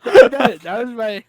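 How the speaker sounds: loud laughing protest, slow. Real, not acted. Host laughing at his own bad accent, real laughter. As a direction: laugh through the excuse. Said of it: "laughing while explaining".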